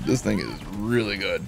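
low, soft aside; understated praise. Real, not acted. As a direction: say it low and quietly impressed. Quiet first take of praise.

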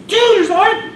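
high, loud frantic shout; desperate shout. Acted. Ambulance driver shouting at the cop about the patient, frantic. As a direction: cry out in panic.

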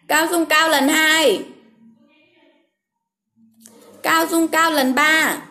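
slow, deliberate name call; calling the winner slowly so viewers can answer; slow reading. Real, not acted. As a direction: read each name slowly on purpose. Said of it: "em đọc chậm mà".

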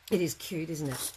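usual speaking voice

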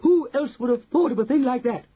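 high, loud chorus of praise; admiring cry. A narrator's reading. Witches marvelling at their leader, high cry. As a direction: marvel loudly, pitched high. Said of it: "cried the audience".

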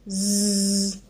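long held "z" with no vowel; buzzing z. Shown as a demonstration. Teacher isolating the sound in zo, held buzzing z. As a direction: buzz the z by itself. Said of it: "je fais le son".

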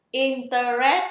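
"interest" as three slow loud syllables, in-tơ-RÉT, rising, no S; common mispronunciation. Shown as a demonstration. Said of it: "rất nhiều người đọc từ này". (đọc sai "interest" thành "in-tơ-rét")

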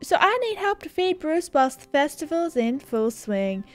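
high put-on character voice, easing off later; hammed-up appeal from a chummy dude; earnest game dude. Character asking help feeding Bruce, high hammed-up plea.

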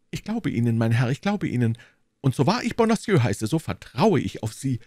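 high, fussy voice of the timid landlord; anxious little burgher. Acted. Bonacieux explaining to D'Artagnan, high and anxious. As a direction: play the nervous shopkeeper, pitched well up.

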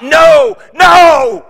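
very high, full-throated scream of protest; desperate loud shout. Acted. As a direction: scream it over the crowd. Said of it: "the louder I got".

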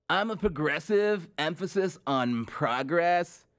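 mock surfer bro, drawn-out second phrase; surfer bro impression. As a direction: parody a candidate's slogan, stretching "progress". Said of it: "that aging surfer bro".

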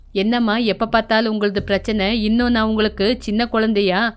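a sullen husband losing patience, then yelling for his wife; voiced drunkard son, irritated and rude; surly son. Narrator voices surly son Saran.

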